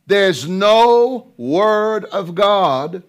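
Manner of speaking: dragged out, every word weighted; slow emphasis. Real, not acted. Preacher slowing to press a truth on listeners. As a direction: stretch it to let it land.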